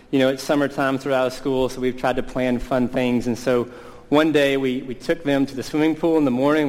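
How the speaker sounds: ordinary delivery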